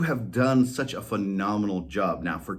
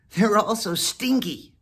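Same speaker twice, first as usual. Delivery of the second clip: higher, louder cat; disgusted cat voice; 11 semitones higher, 4 dB louder than usual. Acted. Whiskers objecting to Molly, higher cat voice. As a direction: wrinkle the nose in the voice.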